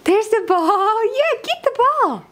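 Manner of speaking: excited. Real, not acted. Cheering toddler on.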